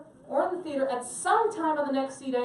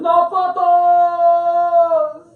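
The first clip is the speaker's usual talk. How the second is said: a long, drawn-out shout, much louder and higher, almost sung; guards' yell; 6 semitones higher, 14 dB louder, much slower than usual. Retold or imitated. Guards in the Sistine Chapel calling to tourists, drawn-out singing yell. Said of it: "The guys yelled".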